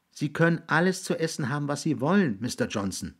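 put-on high, obliging boy's voice; placating boy. Jiggs placates William.

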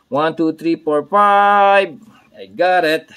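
loud, high self-cheering; hyped countdown. Real, not acted. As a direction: count up loudly to a held high peak. Hyped countdown while working.

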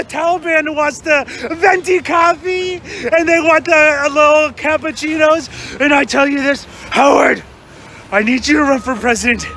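ordinary delivery

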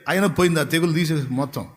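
ordinary talk, nothing marked

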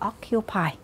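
occupy said OC-cu-py, first syllable stressed. Shown as a demonstration. Teacher gives the answer occupy, first syllable stressed. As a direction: land the weight on the OC in occupy. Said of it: "dấu trọng âm trên vần thứ nhất".

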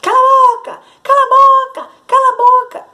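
very high, very loud squawk of cala a boca; the parrot shrieks its old order; parrot screeching. A narrator's reading. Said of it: "começou a gritar". (papagaio gritando cala a boca, muito agudo e alto)

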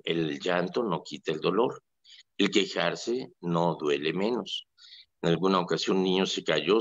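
ordinary talk

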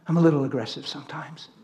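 ordinary talk, nothing marked

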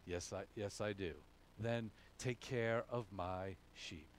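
low, soft, unhurried reply; calm reassurance. Acted. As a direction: gently settle someone who is upset. Acts Jesus calmly answering Peter's outburst.

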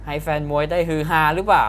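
ordinary talk

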